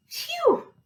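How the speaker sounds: high-pitched "phew" of relief; relieved sigh. A narrator's reading. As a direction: breathe in, then let out a phew. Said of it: "sighed Gilbert".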